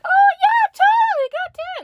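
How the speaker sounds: very high, loud airy cashier voice; mock the cashier's careless yes; hammed-up breezy cashier. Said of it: "And she's like".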